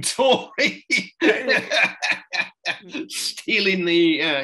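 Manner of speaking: real laughter breaking through the words, slowing down; laughing while telling. Real, not acted. Admitting guilt to the host, laughing sheepishly. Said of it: "I'm telling it now and I'm laughing".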